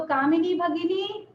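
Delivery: summoning tune on "कामिनी भगिनी"; calling out. Shown as a demonstration. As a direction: call "कामिनी भगिनी" like summoning someone across the room. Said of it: "मेरा intonation से आपको पता चलता है कि मैं किसी को बुला रही हूँ".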